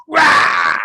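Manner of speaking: loud, high acted war yell; aggressive battle cry. Trainee in aggressive position with rifle, aggressive battle yell. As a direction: roar it out as an attack.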